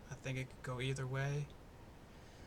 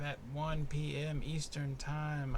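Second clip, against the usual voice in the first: usual speaking voice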